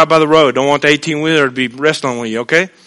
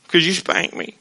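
much softer pouting mumble; sulky child; 9 dB quieter than usual. Retold or imitated. Spanked child answering his parent, sulky and soft. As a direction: mutter it like a sulking kid.

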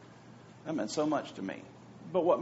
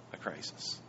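hushed question; whisper; 12 semitones lower, 13 dB quieter than usual. Shown as a demonstration. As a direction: drop to a whisper on crisis. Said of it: "whispered rhetorical question".

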